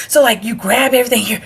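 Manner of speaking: plain reading voice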